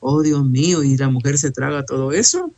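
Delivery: ordinary delivery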